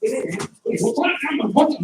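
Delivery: a frustrated bad guy closing in; mock angry attacker. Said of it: "makes me angry, so I come after him".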